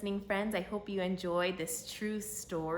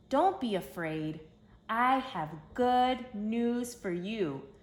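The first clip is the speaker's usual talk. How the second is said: louder, higher and slow announcement; proclaiming angel; 5 semitones higher, 6 dB louder, much slower than usual. Acted. Angel proclaiming good news to the shepherds, loud and slow. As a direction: call it out grandly over the fields.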